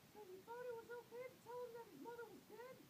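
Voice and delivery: very high accusing cry; shouting. A narrator's reading. Mother accusing father about the lie, high shout. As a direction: shriek the accusation. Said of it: "And Mother shouted".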